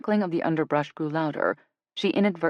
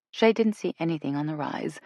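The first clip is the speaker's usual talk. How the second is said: plain reading voice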